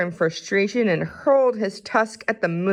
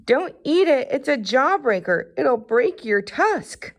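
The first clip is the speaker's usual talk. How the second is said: higher squeaky mouse, urgent; little mouse warning his friend, higher and urgent; 7 semitones higher than usual. Acted. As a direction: warn him like a worried little mouse. Mouse warns Ganesha.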